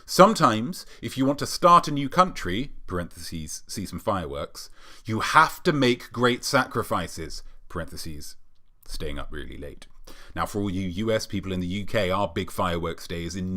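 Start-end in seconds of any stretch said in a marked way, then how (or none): 2.9-4.7 dropped low and soft, like brackets; quiet aside. Pretend. 7.7-9.8 dropped low and soft, like brackets; quiet aside. Pretend.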